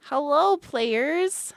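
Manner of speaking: loud, high, bright host hello; excited greeting. Real, not acted. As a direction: greet them with a big welcome.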